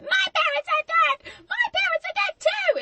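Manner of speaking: put-on high-pitched, loud childish yelling; hammed-up shrill shouted retort; mock shouting match. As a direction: bellow it like squabbling kids outdoing each other. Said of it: "like, shouting matches where it's like".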